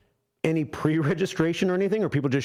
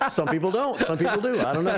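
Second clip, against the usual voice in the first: laughing; 5 semitones higher than usual. Real, not acted. Joking about staying married.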